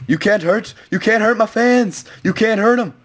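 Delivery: protective shout. Real, not acted. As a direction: shout out to protect your fans. Defending fans in fight.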